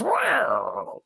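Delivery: high, drawn-out brass wail; imitated band blare. Retelling the band's untimely fanfare, high imitated blare. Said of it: "the band just goes".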